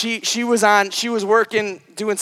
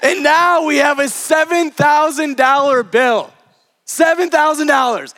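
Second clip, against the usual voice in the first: raised pitch, hammered-out outrage; exasperated disbelief; 6 semitones higher than usual. Retold or imitated. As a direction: stress the huge sum in mock dismay. Exasperated at a surprise bill.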